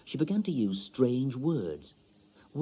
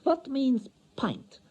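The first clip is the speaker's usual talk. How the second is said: high, slow, careful foreign woman; lightly accented young wife; foreign wife's voice; slower than usual. A narrator's reading. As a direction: lift the voice high and go slow like a learner.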